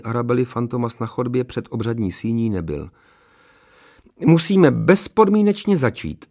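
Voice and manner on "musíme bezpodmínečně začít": high, loud, officious insistence; stern. A narrator's reading. Registrar to the groom at the wedding, stern. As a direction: announce it strictly, raised and emphatic. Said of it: "oznámila stroze Petrovi ceremoniářka".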